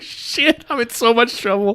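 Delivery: high-pitched comic dread through laughter; mock panic. Pippin realises he made the noise, mock panic. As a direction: squeal it like a guilty culprit.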